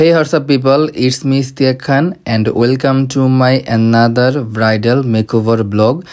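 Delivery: slowed-down second try at the intro; slow restart. Shown as a demonstration. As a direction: ease into it again, very slowly. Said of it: "প্রথম থেকে শুরু করতে হইব".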